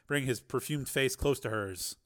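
plain reading voice

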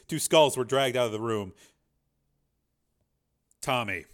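ordinary delivery, nothing marked

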